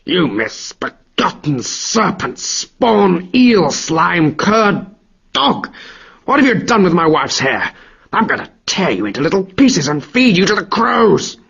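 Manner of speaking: hammed-up enraged god roaring at his foe; roaring insults. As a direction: roar every insult at the cowering thief.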